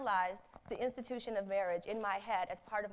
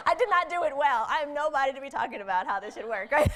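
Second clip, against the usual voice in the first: loud, higher, laughing through the words; real laughter, louder; laughing while talking; 6 semitones higher, 11 dB louder than usual. Real, not acted. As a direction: talk through a big self-mocking laugh. Laughs at own failed marriage.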